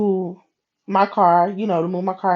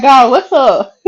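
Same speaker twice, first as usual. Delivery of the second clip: loud, high mock-impatient call; joking demand to God; 5 semitones higher, 7 dB louder, slower than usual. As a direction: jokingly demand an answer from heaven. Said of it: "I ain't telling God, like, what's up".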